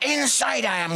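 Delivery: ordinary delivery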